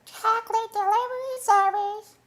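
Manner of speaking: very high, slow synthetic announcer voice; computer voice. Pretend. Streamer voicing a pretend chocolate delivery machine, high and robotic. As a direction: squeak it out like a cartoon robot. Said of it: "this computer voice will go like".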